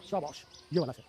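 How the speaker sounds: ordinary talk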